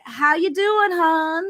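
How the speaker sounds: chatty Southern charm for customers; Southern accent. Retold or imitated. Retail clerk greets customers in a put-on Southern accent. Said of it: "started just talking Southern accent".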